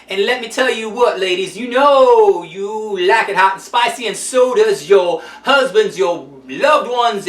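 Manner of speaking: usual speaking voice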